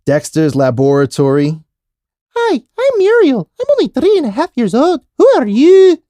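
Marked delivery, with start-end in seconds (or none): 2.4-6.0 very high cartoon girl voice; Muriel. Introducing self as a three-year-old cartoon kid, very high squeaky voice.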